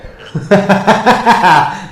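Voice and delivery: loud high gloating cackle; villain laugh. Acted. Villain gloating over Sonic's wound with a loud cackle. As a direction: cackle in triumph.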